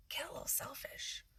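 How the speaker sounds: whisper. Acted. Repeated phrase, whispered aside.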